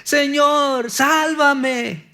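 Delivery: high, loud desperate plea; a frightened cry of Señor, sálvame; crying out for help. Acted. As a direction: call out like a man sinking in the waves. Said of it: "empezó a gritar".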